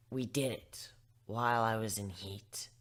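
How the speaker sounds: usual speaking voice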